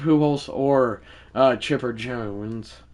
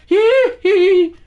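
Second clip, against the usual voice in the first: high falsetto hee hee squeal; a squeaky pop-star hee hee, much higher; 8 dB louder than usual. Retold or imitated. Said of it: "That was an insane voice crack".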